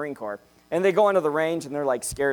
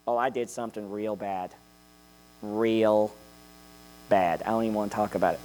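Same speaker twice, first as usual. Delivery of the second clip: low, subdued mock remorse; hushed, hammed-up guilt; mock guilty confession; 6 semitones lower, 5 dB quieter than usual. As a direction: confess it like a shamed sinner. Acting a guilt-ridden believer.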